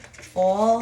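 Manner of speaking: ordinary delivery, nothing marked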